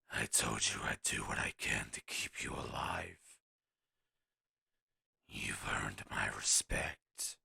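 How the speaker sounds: low, slow, whispered gruff voice; gravel-low mentor whispering respect; deep gruff warrior. Acted. Ripjaw NPC addresses him.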